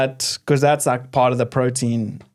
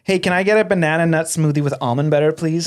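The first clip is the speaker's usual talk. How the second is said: quick, casual American accent; put-on American accent. Shown as a demonstration. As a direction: order briskly in your best American accent. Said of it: "Give us your best American accent".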